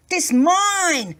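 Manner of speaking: a mulish farmer's retort; stubborn. A narrator's reading. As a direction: dig your heels in. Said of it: "said the farmer stubbornly".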